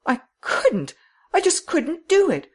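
high, vehement refusal; acted high, loud distress; distressed cry. A narrator's reading. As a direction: insist in anguish, pitched right up. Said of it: "cried Miss Cummins".

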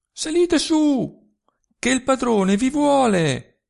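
high, slow call carried down the valley. A narrator's reading. Girl calling the worker up to the master, loud high call. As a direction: call him up, loud and long.

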